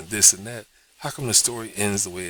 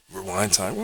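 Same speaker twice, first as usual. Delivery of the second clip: normal talking voice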